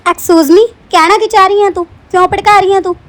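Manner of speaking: loud, indignant demand; offended outburst. Acted. Madam angrily confronts the maid over her hint. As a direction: challenge her hard for the insult.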